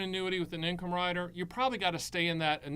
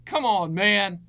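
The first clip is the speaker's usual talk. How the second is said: loud playful "Come on, man" with a falling end; mock challenge; 4 semitones higher, 13 dB louder, slower than usual. Teasing the basketball caller, a loud mock challenge. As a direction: call him out loudly, teasing.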